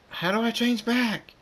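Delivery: high, loud, alarmed question; panicked cry. Acted. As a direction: call it out in rising panic. Thunderstorm panics over his transformation.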